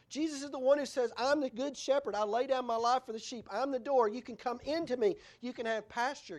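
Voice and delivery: high, emphatic proclamation; forceful preaching. Acted. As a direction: proclaim each saying with force. Preaches Jesus' sayings forcefully.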